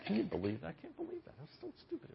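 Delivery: whispered complaint under the breath; whispered grumble. Retold or imitated. A griper muttering about someone, whispered complaint. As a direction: hiss it so nobody hears. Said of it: "say it half under our breath".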